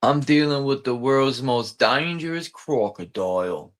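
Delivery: lightly voiced Australian croc hunter; a loose Aussie impression, only half committed; a hint of Australian enthusiasm; Steve Irwin accent. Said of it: "my friend used to do his accent".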